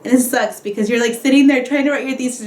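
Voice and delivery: ordinary talk, nothing marked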